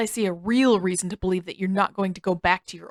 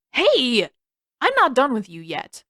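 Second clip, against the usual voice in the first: higher, louder shout after someone walking away; indignant call, raised and loud; calling after him; 4 semitones higher, 4 dB louder than usual. Acted. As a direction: yell it at his back, not finished with him. Sokka shouts after Zuko.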